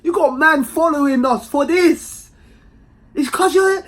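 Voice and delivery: high, loud accusing voice; mock exasperated. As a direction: call her out on the train. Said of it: "I'm saying".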